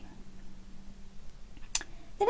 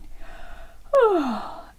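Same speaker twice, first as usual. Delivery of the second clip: long high stretching sigh, falling away; waking sigh; 9 semitones higher, 3 dB louder than usual. Acted. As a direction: sigh like a child stretching awake. Boy stretches awake from dream.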